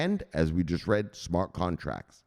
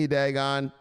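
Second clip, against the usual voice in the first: usual speaking voice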